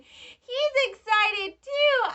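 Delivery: high, loud and drawn-out; playful squealing excitement. Pretend. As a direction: squeal it slowly on the toy's behalf. Speaks excitedly for the toy.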